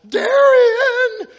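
full-blast high shout of a name; yelling out. Acted. Fan spotting the quarterback in a restaurant, yelling. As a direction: holler it across the room. Fan yells while being removed.

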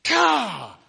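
high, loud mock groan of frustration; exasperated outburst. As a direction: burst out in exasperation. Sighs of exasperation in story.

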